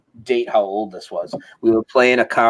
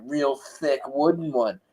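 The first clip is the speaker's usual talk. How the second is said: ordinary delivery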